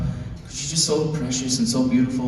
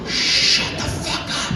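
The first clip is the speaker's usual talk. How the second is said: slow, hushed whisper; slow whispered shush; quiet hiss; 3 dB quieter, much slower than usual. Retold or imitated. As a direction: breathe it right into her ear. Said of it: "quietly cover her mouth ... and go".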